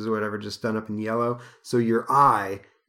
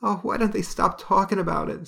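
Lorna upset; 10 semitones higher than usual. Acted. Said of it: "says Lorna".

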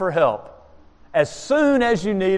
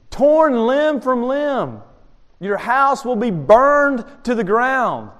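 slow, drawn-out threat, pitched up; grave threat; 6 semitones higher, slower than usual. Acted. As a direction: hammer out the threat slowly, word by word. Dire royal threat read out.

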